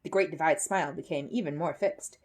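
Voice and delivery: ordinary delivery, nothing marked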